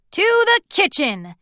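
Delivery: high, loud rallying call; loud announcement. A narrator's reading. Grumpy calling everyone to the kitchen, loud. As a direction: lead the charge. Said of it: "Grumpy announced".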